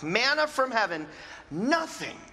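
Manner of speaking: ordinary talk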